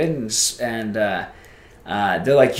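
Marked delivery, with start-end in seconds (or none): none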